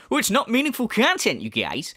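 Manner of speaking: high whiny mock complaint, dropping at the end; exaggerated sneering imitation; mocking quote. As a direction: parrot a critic's grumble. Mimics critic's complaint.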